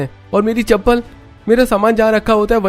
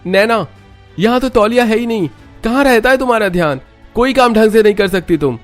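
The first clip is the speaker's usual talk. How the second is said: loud, much higher furious yelling; angry shout; 12 semitones higher, 5 dB louder than usual. Acted. Husband yells at wife about missing towel, furious shout. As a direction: shout so it rings through the house. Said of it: "Rohan की तेज आवाज पूरे घर में गूंज जाती है".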